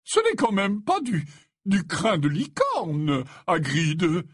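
astonished exclamation. A narrator's reading. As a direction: gasp it in disbelief. Slughorn amazed at the unicorn hair.

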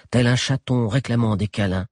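plain reading voice

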